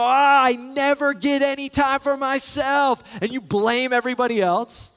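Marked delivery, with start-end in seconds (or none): 0.0-3.0 whiny, sulky mock gripe, pitched up and louder; hammed-up petulant sigh and complaint; pouting complaint. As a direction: act out a self-pitying grumble. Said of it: "Do you pout and complain".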